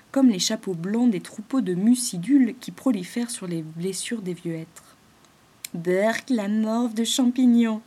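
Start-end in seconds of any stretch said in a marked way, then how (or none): 5.7-7.8 slow shuddering yuck; disgusted. Acted. Rosie imagines slimy mushrooms, shuddering in disgust. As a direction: recoil at the slimy thought. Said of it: "frissonna-t-elle de dégoût".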